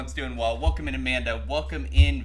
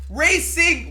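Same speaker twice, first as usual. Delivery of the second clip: sudden high loud yelp; excited yelp; 13 semitones higher, 7 dB louder than usual. Real, not acted. Sudden outburst at game.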